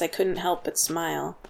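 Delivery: plain reading voice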